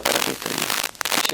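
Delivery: hushed, breathless whisper of despair; barely voiced inner question; whispered to himself. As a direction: whisper it as a private thought. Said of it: "Yo me decía".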